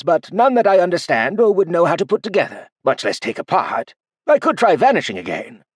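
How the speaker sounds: level with him, a touch playful; candid old man. Acted.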